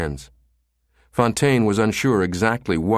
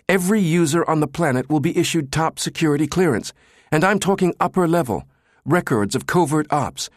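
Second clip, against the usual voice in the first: higher, pressing technician's warning, slowing at the end; urgent Jabba; 8 semitones higher than usual. A narrator's reading. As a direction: hammer home the danger to the director.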